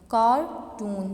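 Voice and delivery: Cartoon with कार punched loud, टून weaker; stress on first syllable. Shown as a demonstration. Teacher showing students word stress, first syllable of cartoon stressed. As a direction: hit कार hard in cartoon, let टून drop. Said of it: "कार ज़्यादा नुमाया है जबकि टून".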